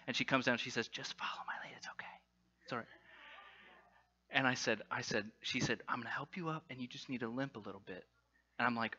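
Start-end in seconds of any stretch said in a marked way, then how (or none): 0.9-2.9 very soft, secret aside; reassuring whisper. Retold or imitated. Mom kneels by her son with a plan, whispering reassurance. 5.9-8.0 hushed coaching. Retold or imitated. Mom privately coaches son's cover story.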